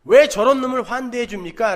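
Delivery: elder son angrily protesting to his father; angry protest. Retold or imitated. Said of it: "화를 내면서".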